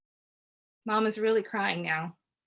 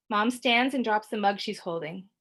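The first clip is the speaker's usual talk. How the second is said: ordinary delivery, nothing marked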